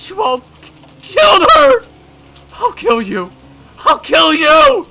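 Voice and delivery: high, loud crying shouts; raging threats. Acted. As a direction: scream it through tears. Mion in murderous rage.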